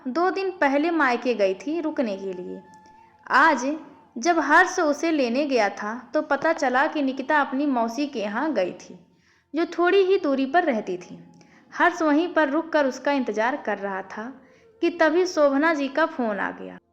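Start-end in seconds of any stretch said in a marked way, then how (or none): none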